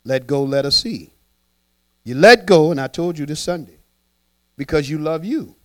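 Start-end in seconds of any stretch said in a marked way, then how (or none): none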